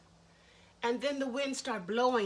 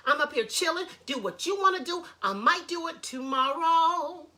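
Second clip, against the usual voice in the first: higher, louder, fed-up brush-off; annoyed attitude; 5 semitones higher, 6 dB louder than usual. Acted. Sangura snapping back at the nagging animals, loud and annoyed. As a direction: tell them all to back off, irritated. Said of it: "he had an attitude".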